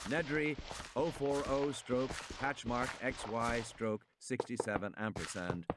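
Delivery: ordinary delivery, nothing marked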